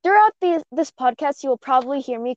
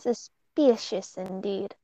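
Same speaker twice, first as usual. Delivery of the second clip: mock suspicious; 3 semitones lower, 7 dB quieter, much slower than usual.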